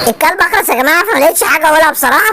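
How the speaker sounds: mock apology. Host loudly apologizing to viewers for the mute dog. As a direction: shout the apology cheekily.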